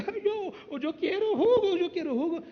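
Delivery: put-on high, whiny little kid begging for juice; whiny child. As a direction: voice a small child whining for juice. Parent voices a crying hungry child. (niño quejumbroso pidiendo jugo con voz aguda)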